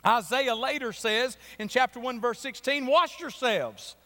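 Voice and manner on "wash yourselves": higher, slow proclamation; scripture reading. Acted. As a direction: lift the verse up slowly. Quotes Isaiah.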